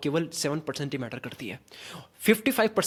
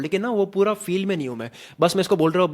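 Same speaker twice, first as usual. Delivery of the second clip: usual speaking voice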